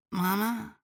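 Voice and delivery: put-on high, drowsy little boy; a sleepy kid calling for mom; sleepy child voice. A narrator's reading. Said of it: "he asked sleepily".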